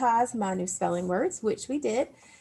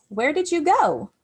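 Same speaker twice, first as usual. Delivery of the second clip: "Where did you go?" read higher and louder with a rising question tune; question intonation; 7 semitones higher, 5 dB louder than usual. Shown as a demonstration. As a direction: ask it like a real question. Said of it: "is a question or an interrogative sentence".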